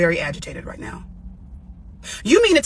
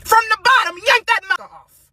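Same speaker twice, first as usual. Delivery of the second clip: angry outburst; 12 semitones higher, 6 dB louder than usual. Real, not acted. Furious rant at a politician.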